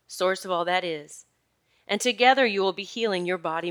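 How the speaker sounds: upbeat promo read with punchy stresses; podcast sponsor read. Acted. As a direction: sell the course warmly and brightly. Reads own course ad.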